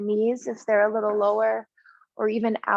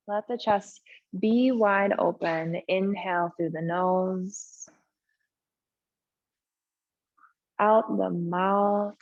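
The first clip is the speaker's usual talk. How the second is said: unhurried yoga relaxation voice with long pauses; slow-paced, settled breathing guidance; guided meditation; 3 semitones lower, slower than usual. Real, not acted. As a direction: ease them gently into stillness, leaving space between phrases. Leads restorative pose and breathing.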